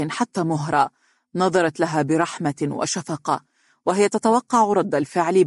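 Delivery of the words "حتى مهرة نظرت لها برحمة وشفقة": plain reading voice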